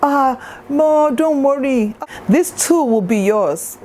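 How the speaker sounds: lightly voiced gushing cousin, high; a hint of an excited cousin reassuring her. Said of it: "proceeds to tell her".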